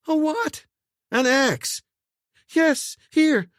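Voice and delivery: high, panicked quick replies; frantic exchange. A narrator's reading. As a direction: blurt in panic. Frantic exchange over an axe.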